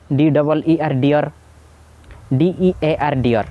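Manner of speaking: "ᱰᱤᱭᱚᱨ" said the same after both spellings, slowly; slow spelled contrast. Shown as a demonstration. Teacher contrasts deer and dear, slowly. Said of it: "ᱵᱟᱱᱟᱨ ᱜᱮ ᱰᱤᱭᱚᱨ ᱜᱮᱵᱚ ᱯᱟᱲᱦᱟᱣᱟ".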